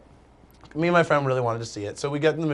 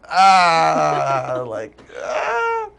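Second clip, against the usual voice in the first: mock scream; 5 dB louder, much slower than usual. Mock panic with friends.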